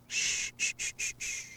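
very soft breathy shushing; gentle shushing. Acted. Calming a crying partner, soft shushing. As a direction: hush them like soothing a child.

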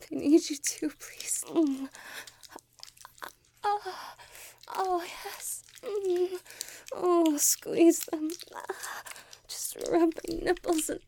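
high, loud, breathless cries building steadily; rising passion. Acted. Speaker pleading with the tentacles, loud and breathless. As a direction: let it swell into loud, pleading moans. Climax of the roleplay.

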